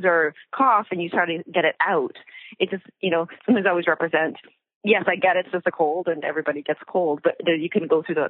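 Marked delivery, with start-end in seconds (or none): none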